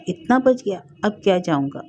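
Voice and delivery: ordinary delivery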